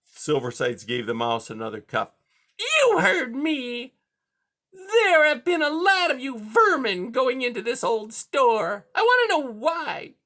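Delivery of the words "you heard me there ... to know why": high, loud interrogating cat; high-pitched bullying villain; menacing cat. A narrator's reading. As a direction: demand an answer in a piercing high voice.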